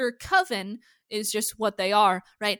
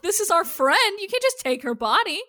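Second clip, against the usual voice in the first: higher, louder indignant objection; outraged protest; 9 semitones higher, 6 dB louder than usual. Retold or imitated. Witches protesting Marcel taking their friend's body, outraged. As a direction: protest as if a friend is being taken.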